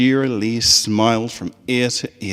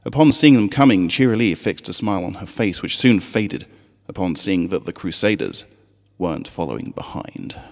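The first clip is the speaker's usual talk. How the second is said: plain reading voice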